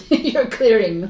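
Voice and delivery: laughing it out, slow and loud; amused laughing repeat. Real, not acted.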